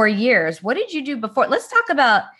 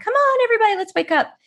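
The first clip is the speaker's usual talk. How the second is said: much higher, energetic rallying cry; high-energy wake-up call; much faster than usual. Acted. Energetic host waking people up, high-energy wake-up call. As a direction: rouse everybody with a very high-pitched call. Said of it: "bring the energy, wake people up".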